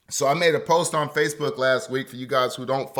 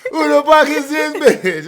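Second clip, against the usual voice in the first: higher, louder, half-laughing repeat; laughing louder disbelief; 13 semitones higher, 8 dB louder than usual. Retold or imitated. Storyteller laughing in disbelief at the girl, louder and higher. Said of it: "I'm in the driver's side like".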